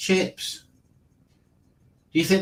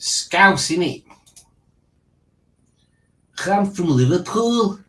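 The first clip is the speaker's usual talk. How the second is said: higher, punchy mock Scouse; Scouse accent; 5 semitones higher, 3 dB louder than usual. Quiz host posing as someone from Liverpool, mock Scouse accent. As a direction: put on a Liverpool voice, pitched up.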